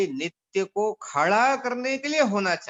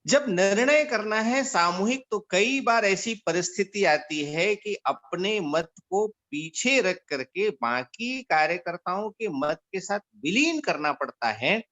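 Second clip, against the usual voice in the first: ordinary talk, nothing marked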